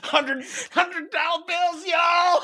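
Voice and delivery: slow, high-pitched showboating holler; mock big-spender shout; pretend money-throwing. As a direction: call out high and drawn out to a crowd. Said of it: "pretending to sh-, throw dollar bills out at the crowd".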